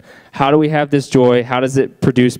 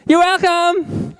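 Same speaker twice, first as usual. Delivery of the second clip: put-on very high, slow and tiny toddler voice; much slower than usual. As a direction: stretch it out like a proud little girl. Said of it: "Maddie looks at her and goes".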